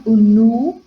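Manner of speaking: "nu" punched loud and held; stressed syllable. Shown as a demonstration. Teacher showing the class the strong syllable, loud stress on "nu". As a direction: hit "nu" hard as the strong syllable. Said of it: "forte for a terceira".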